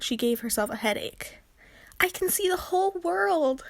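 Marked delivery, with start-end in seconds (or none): 2.0-3.6 high, wondering call from above; joyful cry. Acted. Mia high above, crying out in wonder. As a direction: call out in awe. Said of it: "Mia cried".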